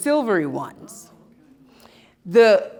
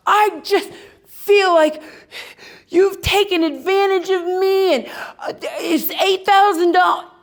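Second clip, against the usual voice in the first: angry confrontation; 11 semitones higher, 5 dB louder than usual. Retold or imitated. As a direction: confront the plumbers, rising to a shout. Confronting the overcharging plumbers.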